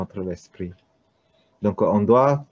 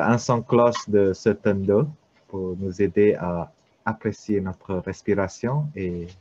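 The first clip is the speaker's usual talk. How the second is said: ordinary talk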